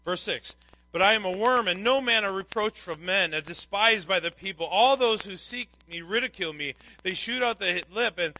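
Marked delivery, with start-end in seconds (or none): none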